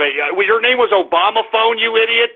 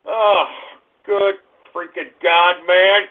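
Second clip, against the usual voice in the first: ordinary talk, nothing marked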